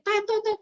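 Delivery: high honking tet tet tet; playful mock car horn; horn honks. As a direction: beep like a car horn in traffic. Imitating car horns.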